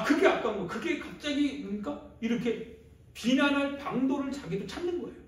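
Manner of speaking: loud, high-pitched sermon delivery; fervent, insistent exhortation; impassioned preaching. Real, not acted. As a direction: drive each point home at full force. Preaching at full force.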